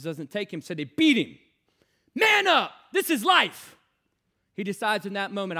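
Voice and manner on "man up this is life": high, loud furious yell; brothers yelling. Retold or imitated. Older brothers yelling at the crying boy, furious. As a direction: bark it like a brutal older brother.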